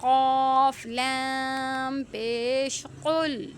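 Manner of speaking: قاف لام پیش قل spelled slowly letter by letter; spelling out a letter. Shown as a demonstration. Teacher spelling a word for students, slow and deliberate. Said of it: "کیسے پڑھیں گے؟".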